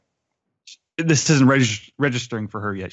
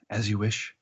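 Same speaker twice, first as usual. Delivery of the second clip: a lightly done gallant hero, murmured and softer; Carrie Elways; 2 semitones lower, 8 dB quieter than usual. Retold or imitated. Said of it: "doing his best Carrie Elways".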